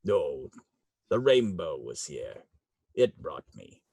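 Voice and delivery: put-on low, soft, hushed old fey; low quiet fey voice. Fergus answering Beltane's questions, low and hushed. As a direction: answer with sullen quiet gravity.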